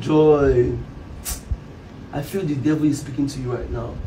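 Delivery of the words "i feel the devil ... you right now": grave quiet. Acted. Husband says it gravely.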